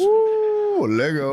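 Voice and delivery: high whooping cheer. Real, not acted. Cheering on the two-on-two game, high whoop. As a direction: hype the game up.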